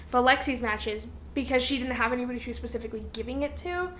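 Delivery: ordinary talk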